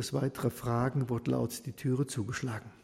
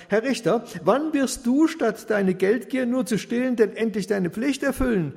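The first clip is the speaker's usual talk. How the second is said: much higher, louder reproach; desperate appeal; 7 semitones higher, 6 dB louder than usual. Acted. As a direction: rush at him and demand it. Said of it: "Da stürzt die Witwe auf ihn zu".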